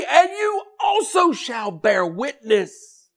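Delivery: high ringing shouted scripture; soaring pulpit delivery; full-force preaching. Acted. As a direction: preach the verses out.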